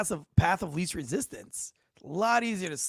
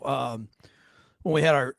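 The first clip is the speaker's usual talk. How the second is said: ordinary talk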